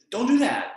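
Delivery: coaching firmly; firm coach warning. Real, not acted. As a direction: warn them off like a coach. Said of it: "as your coach".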